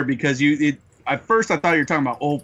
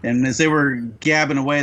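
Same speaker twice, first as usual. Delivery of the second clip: ordinary delivery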